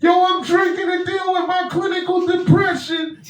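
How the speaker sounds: very loud, high club DJ yelling over music; DJ hype shout. Retold or imitated. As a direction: hype the crowd like a DJ. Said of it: "All I hear is".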